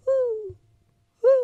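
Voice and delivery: high hooting owl call. Retold or imitated. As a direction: hoot like an owl. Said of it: "she hears a little whoo".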